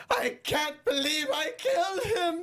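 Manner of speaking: high, slow, tearful wail; imitating a blubbering acting student; mock crying. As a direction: ham up a sobbing breakdown. Mocking the actor's crying scene.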